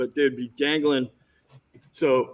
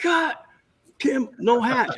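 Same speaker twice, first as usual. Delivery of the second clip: high-pitched mock exasperation; frustrated director; 10 semitones higher, slower than usual. Director as the actor gets out wearing a hat, mock frustrated. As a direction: imitate the fed-up director, voice up high. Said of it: "he's all frustrated".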